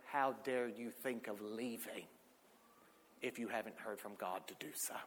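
acted-out hushed reprimand, long pause midway; stern rebuke. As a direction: quietly scold with weight on each phrase. Retelling mentor's quiet rebuke.

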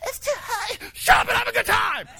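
laughing parental shout; mock parent snap. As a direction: bark it at whiny kids, cracking up. Kids complaining, parent snapping.

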